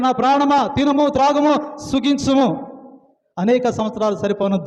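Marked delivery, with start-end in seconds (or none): 0.0-2.7 gloating rich man. Retold or imitated. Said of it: "ఆయన అంటున్న".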